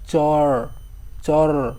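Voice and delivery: চর drawn out, ending on r; bare final r. Shown as a demonstration. Teacher explaining hasanta to students, final r held bare. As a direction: hold চর through to a vowelless r. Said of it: "র উচ্চারণ করতে গিয়ে".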